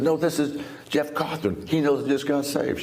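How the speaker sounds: mock-urgent, wide-eyed retelling; excited insistence. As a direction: push it up with amazement. Insisting to girlfriend about phone call.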